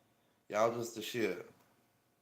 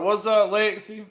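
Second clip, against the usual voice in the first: excited greeting; 10 semitones higher, 11 dB louder than usual. Real, not acted. Greeting a viewer by name.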